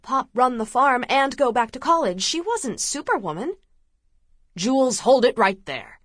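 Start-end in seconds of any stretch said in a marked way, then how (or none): 4.6-6.0 louder, firm command to stop her; forceful command. A narrator's reading. Man orders fleeing fiancée to stop, forceful loud command. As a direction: Cruz halts her with force. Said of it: "The force in Cruz Delgado's tone".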